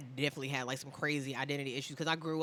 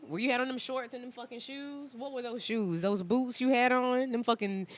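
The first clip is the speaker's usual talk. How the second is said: laughing teasing; 10 semitones higher, 13 dB louder than usual. Real, not acted. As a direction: crack up while roasting him. Teasing friend about old photos.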